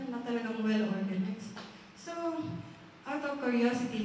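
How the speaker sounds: normal talking voice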